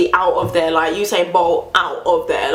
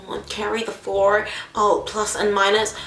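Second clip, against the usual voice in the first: mock laborious mental sums; dragged-out pretend calculating; really slowly; 3 dB quieter than usual. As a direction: imitate a cashier plodding through arithmetic. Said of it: "like, really slowly".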